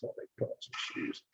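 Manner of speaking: unvoiced under-the-breath whisper. Real, not acted. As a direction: whisper it to yourself. Repeats line, voice drops.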